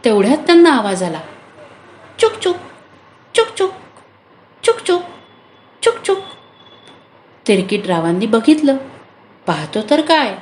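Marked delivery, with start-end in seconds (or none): 2.2-6.2 mouse squeak. Acted. Mouse calls out to the tired animals, high tiny squeaks.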